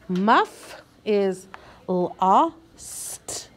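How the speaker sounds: each word stretched, very slow; slow reading. Shown as a demonstration. Teacher modeling slow reading for young readers. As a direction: sound it out slowly for beginners. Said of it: "modeling slow reading".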